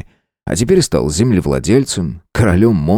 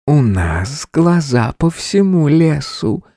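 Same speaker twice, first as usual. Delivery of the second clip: slow hissing female voice in the head; whispery telepathic voice; 7 semitones higher, slower than usual. A narrator's reading. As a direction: breathe it slowly like a seductive spider. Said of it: "снова её голос". (шипящий женский голос в голове)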